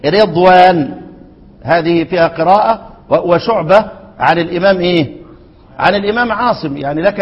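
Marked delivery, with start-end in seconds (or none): 0.1-1.0 رضوان said "redwan" with an "i"-colored first vowel; the others' reading, kasra on رضوان. Shown as a demonstration. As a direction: open رضوان with a short kasra "re". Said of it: "والباقون يقرؤون إيه؟".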